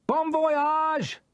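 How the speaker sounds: long ringing call; shouted farewell. Acted. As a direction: sing it out across the water.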